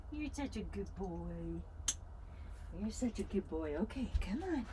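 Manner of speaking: hushed, tender praise; whisper-soft praise for the pup; soft coo to dog. Real, not acted. Owner praising her dog in a hushed coo.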